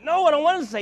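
high, loud whiny refusal; pushing back hard against the request; loud protest. Retold or imitated. Preacher refusing God on the plane, loud whiny protest.

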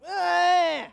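put-on high, loud toddler wail; imitated whiny cry. Parent mimicking kids who can't talk yet, whiny wail. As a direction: imitate a child's wordless whiny demand. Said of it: "nasty, whiny cry".

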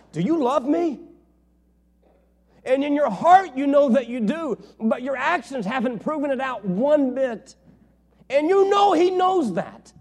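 loud, high, driving preacher's cry; full-force preaching. Acted. Preacher pressing Peter's story on the congregation, full force. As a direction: preach it out at the top of your voice.

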